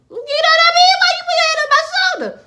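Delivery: very high, loud mock shriek; outraged protest. Acting out being touched on the shoulder, shrieking protest. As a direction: squeal it in hammed-up alarm.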